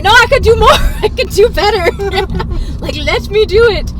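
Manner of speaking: eager insistence. Retold or imitated. Singer insisting to the producer she can do better, excitedly. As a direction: push back keenly, half-laughing.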